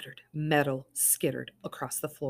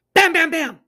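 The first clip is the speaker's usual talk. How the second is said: loud pounding bams, higher; loud knocking; 13 semitones higher, 14 dB louder than usual. Acted. Narrating the cops pounding the door, loud. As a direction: pound the words out like fists on a door.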